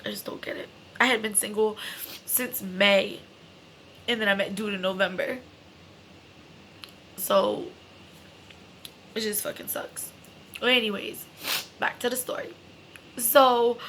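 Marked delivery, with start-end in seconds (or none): 0.1-0.7 low hurt whisper. Real, not acted. After his lies, she whispers her hurt to viewers. 9.2-10.1 quietly whispered frustration; unvoiced mutter. Real, not acted. Hurt by his lies, she quietly whispers to viewers.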